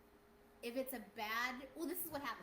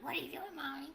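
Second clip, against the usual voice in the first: put-on high, louder old-fairy voice; bad old fairy; 5 semitones higher, 6 dB louder than usual. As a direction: voice the changeling child, higher and louder. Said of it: "Wait, do that again?".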